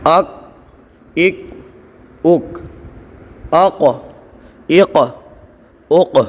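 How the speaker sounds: each syllable "अत" ends in a bouncing echoed stop; slow, separate syllables with a rebound on the final consonant; qalqala drill. Shown as a demonstration. Quran teacher drilling students, bouncing echoed qalqala syllables. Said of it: "आवाज़ पलटेगी".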